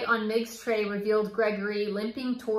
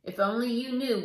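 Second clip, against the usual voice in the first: usual speaking voice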